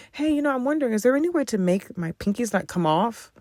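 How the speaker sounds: high, soft, sugary polite request; sweet polite ask. Retold or imitated. To the nail tech, a sweet gentle request. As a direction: ask ever so nicely, not combative. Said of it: "It was just like".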